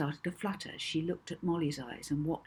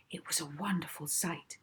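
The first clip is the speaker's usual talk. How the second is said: plain reading voice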